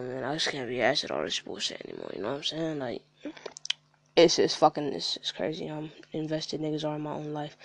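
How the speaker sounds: ordinary delivery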